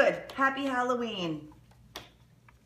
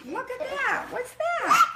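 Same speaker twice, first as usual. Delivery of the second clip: excited; 12 semitones higher than usual. Real, not acted. Parent showing kids the map.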